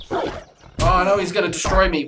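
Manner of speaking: high, loud alarmed cry; panicked yell. Real, not acted. Attacked by wolf in game.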